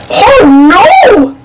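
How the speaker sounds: a high alarmed shout; Goofy seeing the cliff. A narrator's reading. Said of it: "Goofy shouted".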